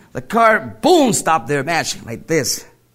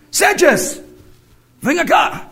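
calling out; 11 semitones higher than usual. Retold or imitated. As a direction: yell his name to get him over. Guy calls him over after killing.

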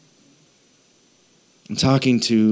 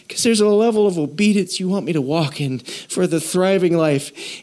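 higher-pitched, emotional prayer to God; emotional pleading; 6 semitones higher than usual. Real, not acted. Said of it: "Sorry for getting emotional today".

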